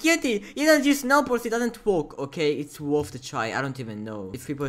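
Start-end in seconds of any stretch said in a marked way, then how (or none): 0.0-2.2 high, loud nagging viewer; mock high-pitched protest; mock commenter. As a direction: imitate a complaining commenter, pitched way up. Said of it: "before you start being like".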